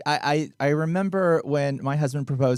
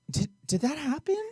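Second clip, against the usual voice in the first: high, tentative retold question; imitating a careful, hesitant inquiry; cautious asking; 9 semitones higher than usual. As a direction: feel it out carefully, pitched up. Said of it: "with caution".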